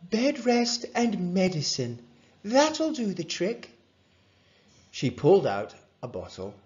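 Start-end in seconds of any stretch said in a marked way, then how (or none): 0.0-3.7 higher-pitched, confident voice; lifted bedside voice; doctor prescribing. A narrator's reading. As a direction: give the patient the remedy.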